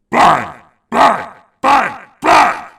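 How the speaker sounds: pounding on the door; loud knocking. Acted. Postman knocking like bombs.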